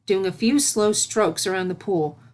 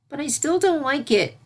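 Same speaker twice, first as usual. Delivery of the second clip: put-on high little-girl voice, a bit sulky; young filly grumbling; 8 semitones higher than usual. A narrator's reading. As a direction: voice her like a small pony who doesn't like it. Filly Sunset complains in story.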